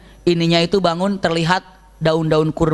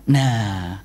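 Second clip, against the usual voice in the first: usual speaking voice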